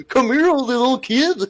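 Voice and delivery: high, slow creep with giggles; giggle, then lure in a high creepy voice; creepy predator voice. Acted. Asked by a friend to redo it, high creepy voice. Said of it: "Fais ta voix de pédo".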